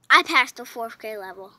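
proud loud boast. Real, not acted. Seven-year-old boasting about her grades, proudly loud.

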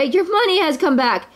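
high, excited good news; gleeful storybook nephew; joyful cry. A narrator's reading. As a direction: announce it with rising delight. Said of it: "cried Louie with joy".

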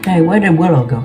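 ordinary delivery

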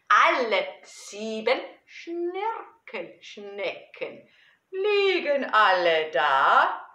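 playful, slow, drawn-out verse with big swings; children's poem recitation. Acted. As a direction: recite it theatrically for small children.

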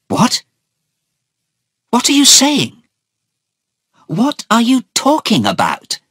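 very high, incredulous outcry; shocked cry. A narrator's reading. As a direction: cry out in alarmed disbelief. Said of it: "Anna cried".

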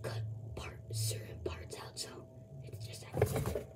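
soft secret whisper. Real, not acted. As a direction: whisper it to the camera. Whispered editing confession.

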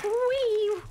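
high, gleeful wee; excited squeal. Real, not acted. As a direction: let out a giddy squeal. Squeal on a swing.

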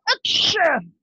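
hammed-up sneeze for the class; fake sneeze. Shown as a demonstration. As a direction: sneeze on cue.

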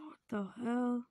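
breathless whisper; whispered surprise. Acted. Stunned onlooker whispering in surprise. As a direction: hush it out in shock.

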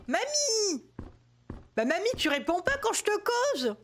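high, drawn-out call for granny. Pretend. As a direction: call out, put out at getting no answer. Calling granny who won't answer.

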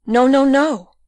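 frantic, emphatic refusal; distressed denial. Acted. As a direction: cry out in desperate protest. Rosa denies the accusation, distraught.

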